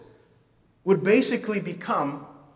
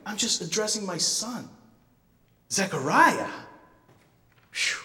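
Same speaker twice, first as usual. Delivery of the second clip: normal talking voice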